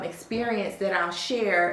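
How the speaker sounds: normal talking voice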